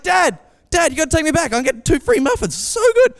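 high, excited pleading with Dad; eager kid. Retold or imitated. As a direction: re-enact a child bursting with good news. Child begging dad in story.

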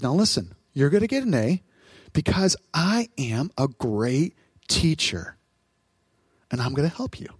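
low, soft, slowing reassurance; calm, kind professor. Acted. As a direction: gently promise the class your help. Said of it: "What's his tone?".

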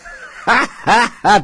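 a high, amused burst of laughter. Acted. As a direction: laugh off the doubting voice. Reacts to the doubting voice.

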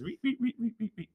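high soft squeaky reets; fox squeak. Pretend. Host guessing a fox noise, high soft squeaks. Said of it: "I don't know what a fox says".

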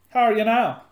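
put-on loud hammy Canadian accent. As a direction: play up the Canadian twang. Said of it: "my Canadian accent".

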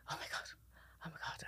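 barely voiced, under the breath; retold stunned whisper to oneself; hushed shock. Retells shock while vomiting outside.

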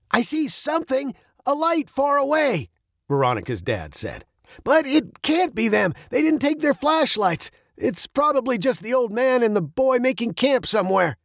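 a dad up a tree, talking it through; dad answering the captain from a high branch, lightly voiced; Veronica's dad.

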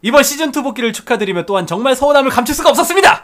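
speed-reading. Shown as a demonstration. Co-host racing through a long listener message, speed-reading. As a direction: race through the long message without stopping. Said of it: "제가 최대한 빨리 읽겠습니다".

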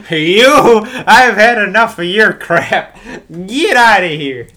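loud, high, slow mock bellow; fed-up umpire. Umpire who has had enough, slow hammed-up yell. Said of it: "he can just look at him and go".

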